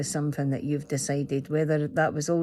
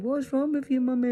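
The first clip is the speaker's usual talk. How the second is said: higher, lightly voiced worried child; little boy; 9 semitones higher than usual. The son woken by her crying, asking in a high child voice. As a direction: ask it the way her son did. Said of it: "He was like".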